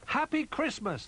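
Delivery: high-pitched festive cheer; excited toys greeting Christmas; joyful cry. A narrator's reading. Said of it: "they cried".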